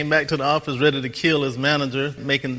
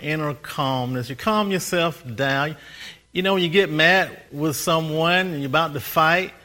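ordinary talk

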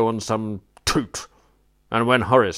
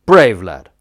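loud, firm approval; hearty praise; 12 dB louder than usual. Real, not acted. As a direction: clap him on the back with your voice. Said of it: "I said".

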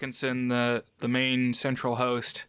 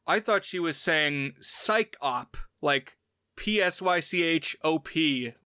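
normal talking voice

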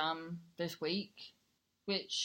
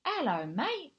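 high, put-on Geordie accent; imitating a Geordie mate's hello; 7 semitones higher, 3 dB louder than usual. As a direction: greet like a proper Geordie lad, pitched up. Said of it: "So I go do this voice".

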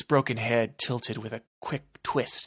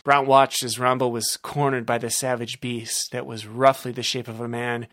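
plain reading voice

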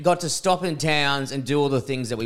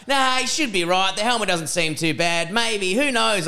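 high, breezy, offhand doctor voice, much higher pitched; careless doctor; 6 semitones higher than usual. Retold or imitated. Mocking the doctor who shrugged off the concussion, high and breezy.

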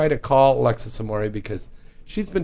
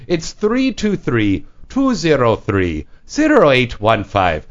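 phone number read out slowly; slow dictation; slower than usual. Real, not acted. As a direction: spell it out digit by digit, slowly. Reads call-in number for listeners.

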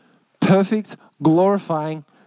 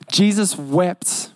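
slow solemn; much slower than usual. Acted. Same verse said again slowly.

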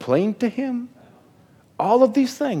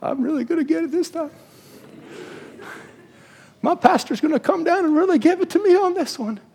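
worried Aaron; 8 semitones higher than usual. Retold or imitated. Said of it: "he's thinking within himself".

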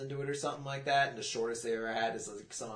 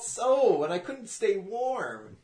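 put-on whiny baby talk; 7 semitones higher, 7 dB louder than usual.

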